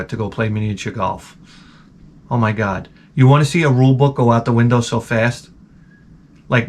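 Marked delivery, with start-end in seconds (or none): none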